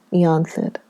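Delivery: ordinary delivery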